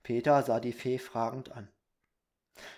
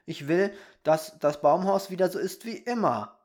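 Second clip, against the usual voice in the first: put-on high, loud little-boy demand; 5 semitones higher, 7 dB louder than usual. A narrator's reading. Boy to fairy about the treehouse, demanding. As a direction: insist like a small boy. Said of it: "verlangte der Junge".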